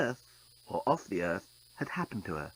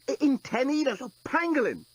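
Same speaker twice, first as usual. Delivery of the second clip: panicked; 13 semitones higher than usual. Acted. Panicked journalist.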